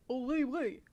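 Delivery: put-on soft French accent; a quick token French accent. Streamer testing a suggested French accent for a character. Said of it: "French accent".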